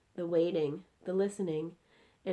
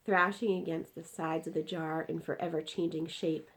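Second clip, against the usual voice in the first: plain reading voice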